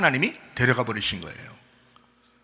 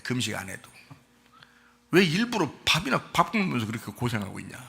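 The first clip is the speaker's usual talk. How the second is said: ordinary delivery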